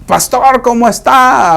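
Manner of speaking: high, eager greeting to the pastor; mock cheerful hello, high and loud. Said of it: "Por eso le decía yo".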